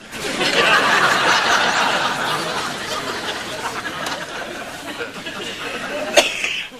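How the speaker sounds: long rasping cough fit. Real, not acted. Drinker choking on his drink, long coughing fit.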